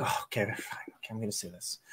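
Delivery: hushed, breathy self-talk under the breath; whispering to herself. Retold or imitated. Dealer whispering nervously to herself before facing the drunk. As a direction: whisper it as if nobody should hear. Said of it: "I'm like".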